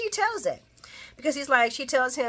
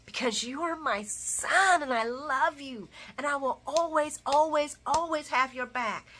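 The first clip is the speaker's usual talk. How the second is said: motherly reassurance; 5 semitones higher than usual. Retold or imitated. Laura soothing her grieving son, mock tender. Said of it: "And he's like".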